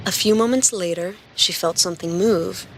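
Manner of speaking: ordinary talk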